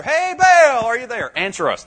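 high, loud mock call to an absent god; mocking the prophets. Preacher imitating Baal's prophets calling out. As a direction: play the frantic prophets, half mocking. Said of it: "these guys jumping around an altar".

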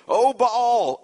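high, loud cry to a god; calling out to Baal. Retold or imitated. Prophets of Baal crying to their god, a loud high call.

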